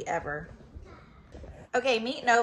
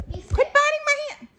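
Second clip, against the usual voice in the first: dog's voice; 5 dB louder than usual. Pretend. Dog voice.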